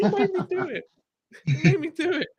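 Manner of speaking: high-pitched squeal through laughter; helpless high giggly protest; laughing mock protest. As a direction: plead your case while cracking up. Mock protest to locals, breaking into laughter.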